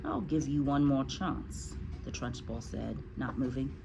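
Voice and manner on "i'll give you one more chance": low, slow, soft warning; quiet menace. Acted. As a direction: warn the pupil slowly and softly. Menacing headmistress warns.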